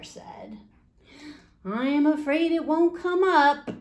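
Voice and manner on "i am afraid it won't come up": mother's voice, higher and louder; worried mother. Acted. Mother telling the boy it won't grow, higher and louder. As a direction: voice the fretful mother, up high.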